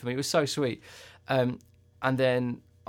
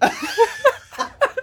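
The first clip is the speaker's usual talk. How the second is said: a high, loud burst of real laughter; 9 dB louder than usual. Real, not acted. Laughing at the photo story.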